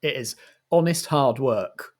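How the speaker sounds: usual speaking voice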